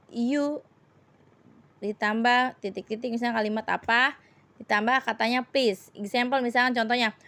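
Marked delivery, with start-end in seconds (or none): none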